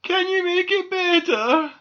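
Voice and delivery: very high, loud wail; whimpering plea. A narrator's reading. Squirrel begs doll to help, high whimpering. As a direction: plead like a hurt child. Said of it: "whimpered Squirrel".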